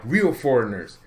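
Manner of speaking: usual speaking voice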